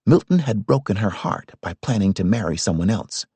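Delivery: ordinary delivery, nothing marked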